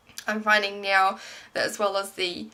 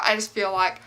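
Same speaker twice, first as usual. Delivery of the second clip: ordinary talk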